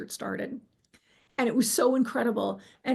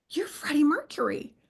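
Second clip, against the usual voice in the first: starstruck fangirl; 5 semitones higher than usual. Retold or imitated. Meeting her idol [name] in heaven, starstruck fangirl gush. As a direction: gush like a starstruck teenage fan. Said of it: "I turned into this, like, fangirl".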